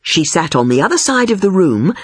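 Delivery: ordinary delivery, nothing marked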